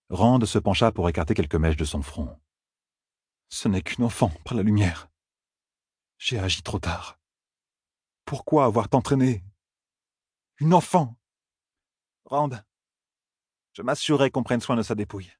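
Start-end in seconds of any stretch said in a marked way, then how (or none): 3.5-11.2 put-on a young man's voice rising into anguished cries; Rand grieving. A narrator's reading. Rand over the girl's body, anguish rising. 12.2-15.3 a higher, measured woman's voice; calmly promising to take care of the body; Moiraine's voice. A narrator's reading. Moiraine to Rand about the body, higher and measured. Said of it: "fit Moiraine".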